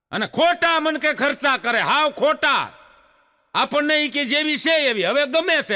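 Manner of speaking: complaining husband griping about beauty parlour costs, mock exasperated; venting complaint. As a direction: whine about the wasted money. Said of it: "બિચારો વરાળું કાઢતો હતો".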